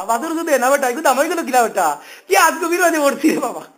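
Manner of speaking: usual speaking voice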